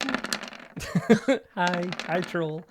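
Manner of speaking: small high-pitched hello; sheepish greeting. Acted. Makaria as the troll looks up at her, sheepish greeting. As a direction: greet it meekly from above.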